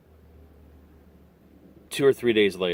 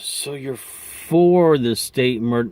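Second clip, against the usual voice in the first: disbelieving pollster; 6 semitones higher, 6 dB louder, slower than usual. Retold or imitated. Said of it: "And she goes".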